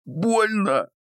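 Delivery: squeaky high cry of pain. Acted. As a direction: yelp it out in pain. Captive in pain.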